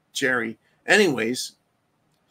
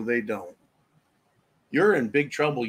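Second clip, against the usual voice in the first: ordinary talk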